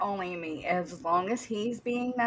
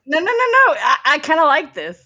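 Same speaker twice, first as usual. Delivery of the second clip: excited; 7 semitones higher, 11 dB louder than usual. Real, not acted. Excitedly protests, then enjoys the joke.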